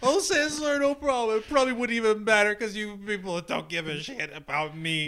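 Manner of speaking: very high, loud mock rant; unhinged whiny outburst; hysterical.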